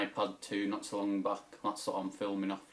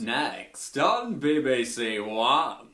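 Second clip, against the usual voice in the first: slow, higher announcer voice trailing the next show; voiced like a BBC announcer; TV continuity announcer; 3 semitones higher, 7 dB louder, slower than usual. Doing impressions for his video, a slow BBC announcer. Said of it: "do some impressions".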